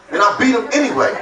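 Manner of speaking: ordinary talk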